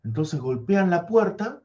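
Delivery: plain reading voice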